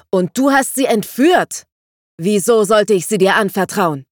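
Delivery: high, loud outraged challenge; angry accusation. Acted. Father accuses the kidnapper, loud and outraged. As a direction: hurl the accusation at him. Dandelion continues the angry quarrel.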